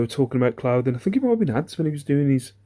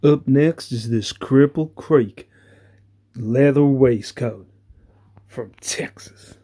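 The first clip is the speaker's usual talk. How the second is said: drawn-out showman intro; 3 dB louder, much slower than usual. Pretend. Dramatic product intro.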